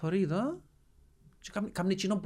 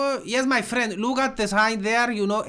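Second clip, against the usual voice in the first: slow, simplified English for a delivery man; lightly mocking slow foreigner-talk; dumbed-down English; 3 semitones higher, 3 dB louder, much slower than usual. Shown as a demonstration. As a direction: spell it out slowly like to a foreigner. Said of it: "να κατεβάσω το επίπεδο μου".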